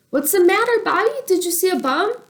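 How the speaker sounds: put-on little girl voice. Little sister asking why brother froze, girlish voice. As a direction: ask like a worried small child. Casey's worried question.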